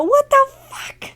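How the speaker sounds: high, loud, slow mock shock; the family blurts it out, hammed-up; stunned outburst. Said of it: "And they go".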